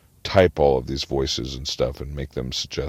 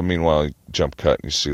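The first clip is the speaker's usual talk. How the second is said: ordinary talk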